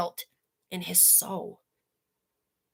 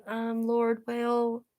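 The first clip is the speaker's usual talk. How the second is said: timid tiptoeing; 5 dB quieter than usual. Pretend. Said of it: "He's not tiptoeing around the Lord".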